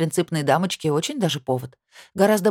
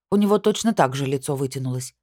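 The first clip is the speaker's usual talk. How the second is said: usual speaking voice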